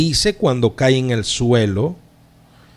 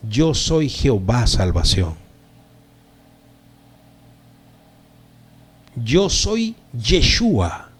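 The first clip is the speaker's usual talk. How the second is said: slow, deliberate declaration with long pauses; divine voice; 3 semitones lower, slower than usual. Acted. Preacher voices what Saulo hears, slow and solemn. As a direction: proclaim each name slowly and apart. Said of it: "que la voz le dice".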